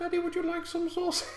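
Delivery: put-on very high childlike voice; high squeaky kid voice. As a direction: squeak it like a little boy. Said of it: "He's like".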